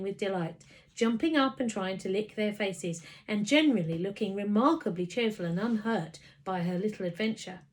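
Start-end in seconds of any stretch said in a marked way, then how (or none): none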